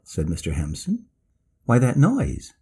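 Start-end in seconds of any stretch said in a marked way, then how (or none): 1.6-2.5 excited exclamation. A narrator's reading. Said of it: "he exclaimed".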